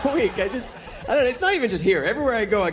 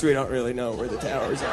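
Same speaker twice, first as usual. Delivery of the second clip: lower, softer clueless rep; a hint of a shrugging rep; lightly voiced company rep; 8 semitones lower, 4 dB quieter than usual. Comic mimicking the phone company, lower and softer.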